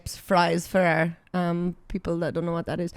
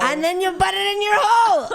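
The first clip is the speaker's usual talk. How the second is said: very high, excited shout; pitched way up, hammed up; over-the-top exclamation; 11 semitones higher, 4 dB louder than usual. Real, not acted. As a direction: cry it out with wild comic gusto. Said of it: "that was insane".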